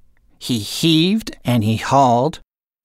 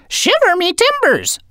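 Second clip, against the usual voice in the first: squawking parrot, much higher; parrot echo. A narrator's reading. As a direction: screech it back like a parrot. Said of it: "Salty echoed".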